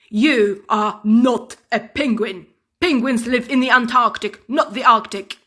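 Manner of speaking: loud angry telling-off; loud indignant rant; furious presenter. Acted. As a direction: scold the cub loudly. Man corrects cub.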